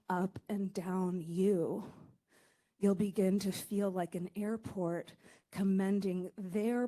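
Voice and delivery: low, slow, measured poem reading; poetry reading. Acted. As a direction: let each image land slowly and gravely. Reads her poem aloud.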